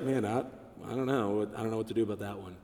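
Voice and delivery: a defeated helper giving up; baffled volunteer. Retold or imitated.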